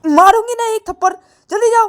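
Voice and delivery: high loud threat; threatening slap. Acted. Payal threatens to slap Ananya, loud and high. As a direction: raise the hand and threaten.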